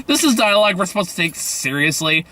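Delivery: ordinary talk, nothing marked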